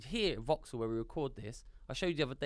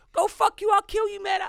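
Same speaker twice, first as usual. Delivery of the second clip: very high, loud fake-hard yell; hammed-up threatening shout; fake tough shout; 11 dB louder than usual. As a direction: yell to act tough and fool nobody. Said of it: "I'm gonna show him how hard I was".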